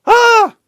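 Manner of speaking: short high loud mock shriek; mock panicked scream. Commentator mimics claustrophobic agoraphobe journalists, high mock shriek.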